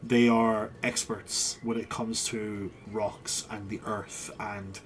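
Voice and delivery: low, measured royal advisor; Kernan's earnest counsel. Acted. GM voices Kernan's speech.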